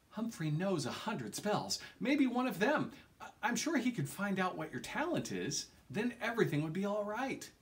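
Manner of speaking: put-on eager girl pitched well above the narrator; Sabrina's eager voice. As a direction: voice her bright and hopeful, a laugh in the middle.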